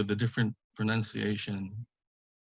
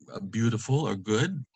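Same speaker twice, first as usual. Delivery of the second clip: ordinary delivery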